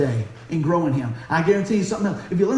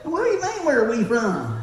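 mock bewildered local; 3 semitones higher than usual. Local answering puzzled strangers, high mock bewilderment.

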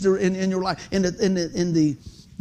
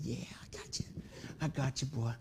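low, near-whispered boast; hushed taunt; 7 semitones lower, 15 dB quieter than usual. Retold or imitated. Son holding dad in a chokehold, hushed cocky taunt. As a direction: murmur it close into his ear.

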